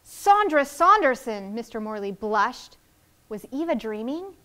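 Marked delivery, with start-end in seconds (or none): 0.0-1.5 star-struck. Acted. Star-struck neighbor blushes.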